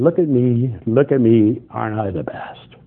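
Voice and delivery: mock show-off. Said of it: "You're up there saying".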